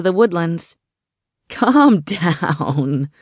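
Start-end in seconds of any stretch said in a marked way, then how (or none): 1.5-3.1 laughing. A narrator's reading. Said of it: "a voice said, laughing".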